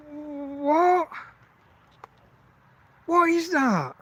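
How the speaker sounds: high baffled outburst; real incredulity at the stranger's behaviour; baffled what. Real, not acted. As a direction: ask in disbelief, pitch up high. Baffled by the visitor.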